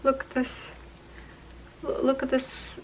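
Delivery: softly, almost speechless with delight; real quiet overwhelmed murmur; hushed awe. Real, not acted. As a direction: hold your breath as you show it. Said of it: "I can't speak, my friends".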